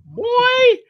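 put-on "Boy" in a very high falsetto; silly squeal. Host repeats the viewer's name, silly squeal.

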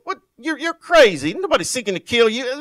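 mock indignant denial. Preacher mocking the Pharisees' denial, loud and scoffing. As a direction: play the Pharisees brushing him off as crazy. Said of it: "And they go".

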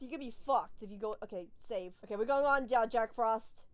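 normal talking voice

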